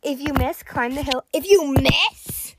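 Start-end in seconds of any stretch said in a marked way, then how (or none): 1.3-2.5 stretched-out, incredulous repeat; drawn-out shocked question; alarmed disbelief. Real, not acted. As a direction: repeat it slowly, aghast.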